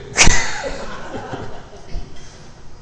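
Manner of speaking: a high airy laugh; high breathy laugh. Real, not acted. Preacher laughing at her own story, high airy laugh.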